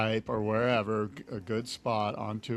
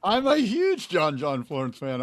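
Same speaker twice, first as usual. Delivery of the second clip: emphatic protest; 5 semitones higher, 6 dB louder than usual. Real, not acted. Protesting fan accusation.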